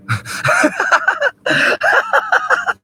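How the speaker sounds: loud, high-pitched burst of laughter; cackle. Real, not acted. Cracking up at own rambling logic, high cackle. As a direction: cackle loudly, high and sustained.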